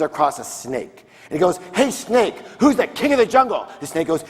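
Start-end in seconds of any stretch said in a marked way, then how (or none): 1.8-3.7 boastful lion. Acted. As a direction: call out like the cocky king of beasts. Lion challenging animals in joke.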